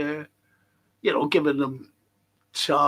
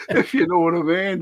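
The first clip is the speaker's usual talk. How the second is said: ordinary talk, nothing marked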